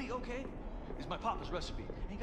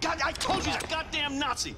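angry shout; 9 semitones higher, 6 dB louder than usual. Acted. Accusing stranger angrily.

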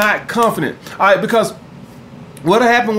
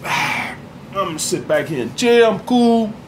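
relaxed chill; 4 dB quieter than usual. Shown as a demonstration. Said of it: "when you chill and you relax".

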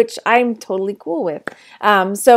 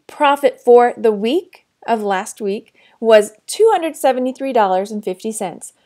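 normal talking voice

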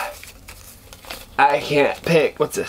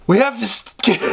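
mock angry Cage; 5 semitones higher than usual. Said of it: "He's kinda angry and loud like this".

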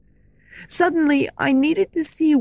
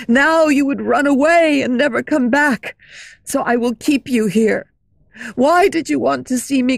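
louder, raging tormented shout; 4 dB louder than usual. Acted. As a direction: scream at her in torment. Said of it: "He was shouting".

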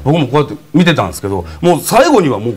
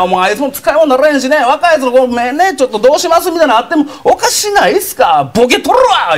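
愚痴る兄貴; 9 semitones higher than usual. Retold or imitated. 兄貴の愚痴の口真似.

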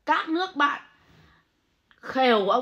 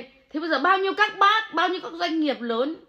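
indignant; 6 semitones higher, 4 dB louder than usual. Real, not acted. Monk ranting at critics.

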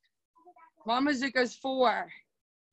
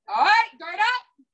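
called command; 5 semitones higher, 5 dB louder, slower than usual. Real, not acted. Instructor calling the class to guard.

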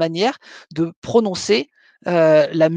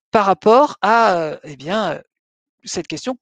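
ordinary talk

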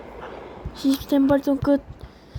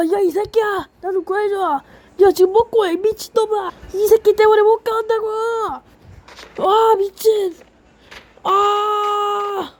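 frantic shouting; 6 semitones higher than usual. Real, not acted. Player yelling at teammate to rescue him. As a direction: scream for help from a teammate.

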